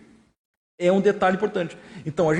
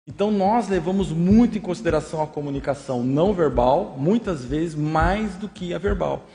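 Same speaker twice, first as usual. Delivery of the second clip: usual speaking voice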